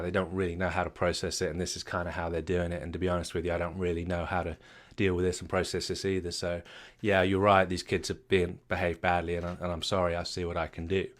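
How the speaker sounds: a widowed father quietly apologizing to an angry passenger; quiet grieving father. Acted. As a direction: speak gently, worn down by grief.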